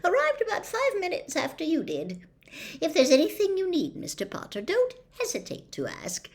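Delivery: an eager-to-please landlord offering help; polite old innkeeper, a touch quick; obliging innkeeper. A narrator's reading. Innkeeper Tom keeps speaking.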